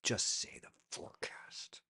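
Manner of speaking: unvoiced whisper. Real, not acted. A whispered aside to interns about plain forecasts. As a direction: breathe it out as an aside.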